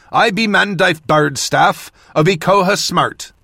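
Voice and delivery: put-on northern accent. A narrator's reading. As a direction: let the northern accent slip in as you hail. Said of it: "allowing my own northern accent to slip in".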